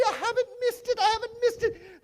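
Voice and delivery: high, breathless delight; giddy joy. Acted. Scrooge rejoicing that he has not missed Christmas, giddy and high. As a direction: squeal it like a giddy child.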